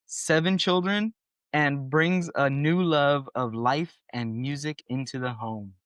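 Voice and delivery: ordinary talk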